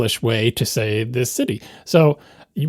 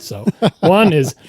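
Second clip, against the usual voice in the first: ordinary talk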